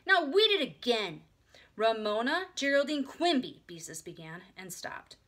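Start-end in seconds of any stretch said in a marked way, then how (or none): 0.1-1.2 high, loud, slow order; demanding child. A narrator's reading. Little sister demands a rereading, demanding. 1.8-3.6 high, loud full-name rebuke; scolding. A narrator's reading. Big sister begins a lecture, scolding.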